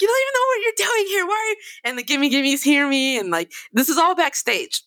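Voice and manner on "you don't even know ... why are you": fast, high-pitched ranting; re-enacted high, heated tirade; retold rage. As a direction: rattle off an exasperated telling-off. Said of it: "I already raged at him".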